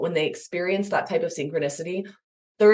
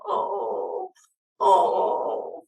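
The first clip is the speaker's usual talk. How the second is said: empathetic groan. Shown as a demonstration. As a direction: echo the child's pain gently. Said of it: "You can just go".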